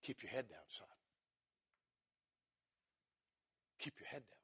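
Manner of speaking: soft, near-silent echo of the drill call; hushed memory. Retold or imitated. Preacher softly recalling the instructor's drill as his son hits the ground. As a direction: let the old command return as a whisper.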